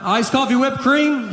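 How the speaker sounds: high-pitched call-out, no real volume; mock yell. Barista announcing a drink to waiting customers, mock yell. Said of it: "She just yells out".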